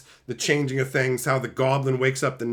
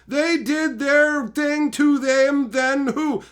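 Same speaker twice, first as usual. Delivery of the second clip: mock confused reading; 9 semitones higher, 3 dB louder, much slower than usual. Mock reading of pronoun sentence.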